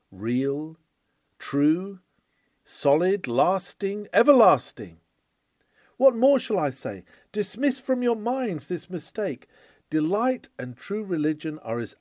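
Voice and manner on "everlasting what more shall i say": usual speaking voice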